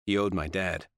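ordinary delivery, nothing marked

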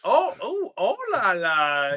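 high, loud, slow "oh la la"; exaggerated French chef flourish; French accent. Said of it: "Even better".

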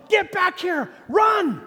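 high, urgent yell; imitated yelling dad. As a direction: yell it like a dad calling his child out of danger. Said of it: "like, yelling".

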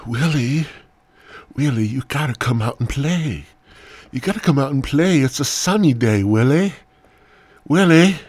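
high, loud sing-out call; playful coaxing. Acted. Coaxing sleepy Willie to play.

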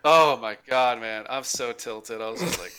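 ordinary delivery

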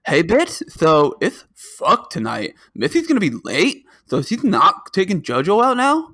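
playful Homestar impression. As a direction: read the last text as a silly cartoon character. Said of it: "in my best Homestar".

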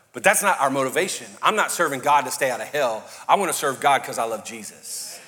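ordinary talk, nothing marked

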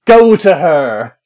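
loud, slow, drawn-out urging cry; mock urgent shout. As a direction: belt it out like a movie cue. Bartender urging him, acted.

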